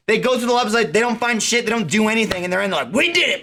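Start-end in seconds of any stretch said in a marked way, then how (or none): 2.9-3.4 high, proud declaration; hammed-up triumph; mock victory cheer. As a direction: celebrate like a team that won. Mocking a fake victory.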